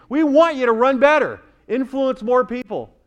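high, loud preaching cry; impassioned exhortation. Real, not acted. Preacher urging young people to run better, impassioned. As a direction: drive the charge home forcefully.

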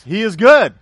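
loud proclamation. Acted. Preacher proclaims point.

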